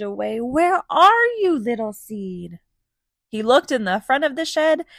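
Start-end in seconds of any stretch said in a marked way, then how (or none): 0.4-2.6 calling out. A narrator's reading. Fox searching, calling.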